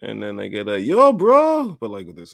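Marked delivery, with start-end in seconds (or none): 0.8-1.8 put-on "Yo, bro" in a high, loud Russian accent; thick Russian accent. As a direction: greet him like a Russian stranger. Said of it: "thick Russian accent".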